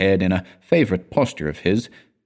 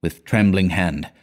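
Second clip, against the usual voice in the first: ordinary delivery, nothing marked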